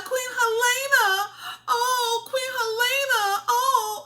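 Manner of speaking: high, wailing cries of Queen Helena; imitating mourners crying out to the queen; mock wailing crowd. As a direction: wail her name like a grieving crowd, hammed up. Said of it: "crying out to Queen Helena".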